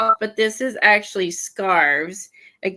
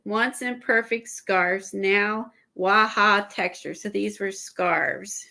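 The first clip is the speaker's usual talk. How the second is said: usual speaking voice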